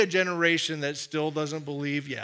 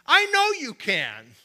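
"I know you can" said louder, higher and slowly, rising with conviction; emphatic faith; 9 semitones higher, 7 dB louder, slower than usual. Shown as a demonstration. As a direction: proclaim "I know you can" with bold certainty. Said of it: "is not from God".

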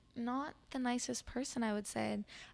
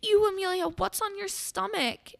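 disgusted; 10 semitones higher, 7 dB louder than usual. Retold or imitated. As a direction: stretch the question out in exaggerated disgust. Retells dancer's disgust at belly button.

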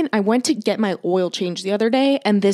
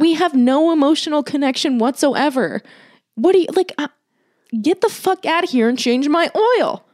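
exasperated rant; 7 semitones higher, 4 dB louder than usual. Retold or imitated. Ranting at the mechanic.

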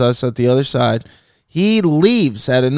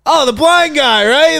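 laughing it off; 9 semitones higher than usual. Retold or imitated. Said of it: "trying to laugh it off".

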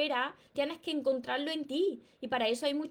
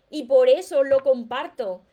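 much louder and slower; emphatic; 10 dB louder than usual. Real, not acted. Author to viewers explaining why she shares her books, loud and slow. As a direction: land it firmly. Coach stressing her sharing.

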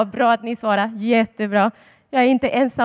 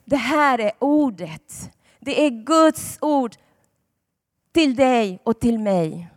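ordinary talk, nothing marked

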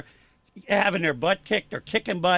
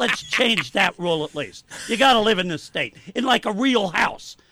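louder, punchy emphasis; fired up; 5 semitones higher, 5 dB louder than usual. Real, not acted. Commentator venting about an unfair residency rule, fired up. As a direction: get it out of your system, forcefully. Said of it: "It fires me up".

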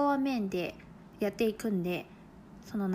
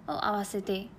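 usual speaking voice

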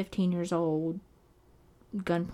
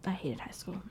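whispering; 9 dB quieter than usual. Real, not acted. Confessing hated school, under breath.

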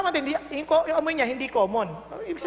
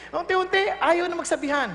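ordinary talk, nothing marked

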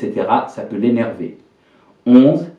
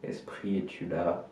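seance medium; 5 semitones lower, 17 dB quieter, slower than usual. Acted. Hushed invocation after silence.

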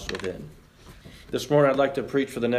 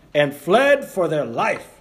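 usual speaking voice

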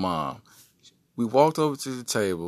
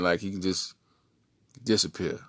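usual speaking voice

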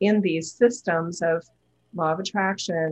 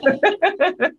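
high bright laugh; 10 semitones higher, 6 dB louder than usual. Real, not acted. Laughing at her own impression.

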